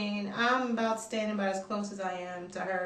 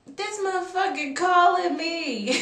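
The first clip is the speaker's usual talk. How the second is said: loud, high, slow exclamation; drawn-out, high incredulous cry; outraged disbelief; 8 semitones higher, 6 dB louder, slower than usual. Retold or imitated. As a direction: act out shocked disbelief at the caller. Acts out seeing his call.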